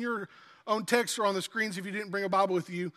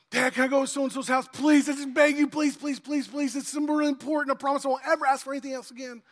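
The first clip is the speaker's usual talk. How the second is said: higher, fast mock-pleading kid; child begging dad; 5 semitones higher, 3 dB louder than usual. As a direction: play a kid pestering dad, hammed up.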